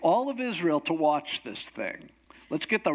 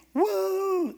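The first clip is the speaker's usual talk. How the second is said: very high, held "woo" of a cheering crowd; imitated high cheer; crowd's whoop. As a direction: whoop like a thrilled onlooker.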